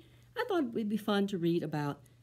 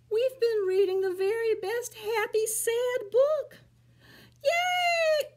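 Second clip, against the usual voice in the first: much higher sheepish admission; guilty monkey; 8 dB louder, slower than usual. Acted. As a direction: confess with a guilty grin. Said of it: "admits with a guilt-ridden look".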